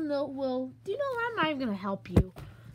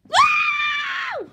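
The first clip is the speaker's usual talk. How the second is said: high shrieking scream of terror; terrified scream; 7 dB louder than usual. Acted. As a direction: shriek as the nightmare grabs him. Monster attacks, terrified scream.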